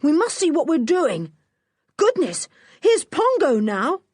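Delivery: yelled order. Acted. Julian yelling to George for light, urgent. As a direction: hurry her in the dark. Julian still shouting in fight.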